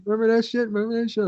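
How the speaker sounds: high, mock-excited friends voice; mocking old friends. Old friends tease him about his uncle, in a high mocking voice. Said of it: "They're like".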